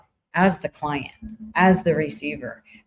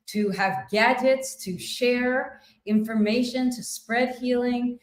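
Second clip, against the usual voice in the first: fervent gratitude prayer; 6 semitones higher than usual. Real, not acted. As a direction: proclaim thanks to the universe, pitched high. Fervent prayer of thanks to universe.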